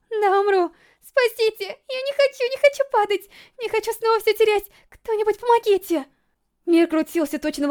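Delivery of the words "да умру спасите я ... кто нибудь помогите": high, desperate pleas for help; panicked cries. A narrator's reading. Falling racer begging anyone for help, panicked. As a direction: cry out in mounting terror.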